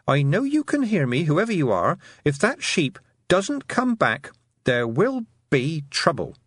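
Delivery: high, deliberate threat; stern warning. A narrator's reading. Tiffany threatens hidden creatures in the field, stern warning. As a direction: warn them slowly at the end, voice pitched high. Glaring girl threatens unseen thieves.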